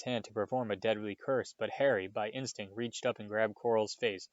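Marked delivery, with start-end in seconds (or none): none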